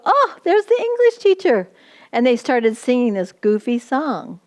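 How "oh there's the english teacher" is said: high, delighted call of recognition; excited greeting. Retold or imitated. Rwandan pastors greeting the returning teacher, excited high call. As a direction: greet her gleefully from across the room. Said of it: "people go".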